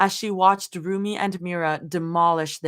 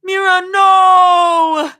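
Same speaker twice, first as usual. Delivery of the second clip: high, drawn-out wail of NO; loud, melodramatic cry, held long; dramatic yell; 12 semitones higher, 12 dB louder, much slower than usual. A narrator's reading. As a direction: scream it to the sky. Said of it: "Rumi yelled into the sky".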